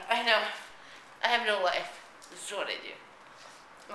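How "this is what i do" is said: whispered aside. Real, not acted. Self-mocking aside, whispered.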